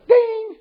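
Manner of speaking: high-pitched ringing ding; imitated email ding. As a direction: sing out a high notification chime.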